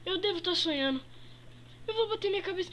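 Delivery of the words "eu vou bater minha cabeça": ordinary talk, nothing marked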